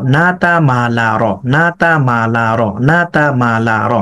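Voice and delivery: "না তা মা লা র" chanted as one linked run, repeated evenly; syllables flow together without gaps, rhythmically repeated; joined-up drill. Shown as a demonstration. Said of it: "পড়াটাকে split করেন এভাবে". (একটানা মিলিয়ে বারবার পড়া)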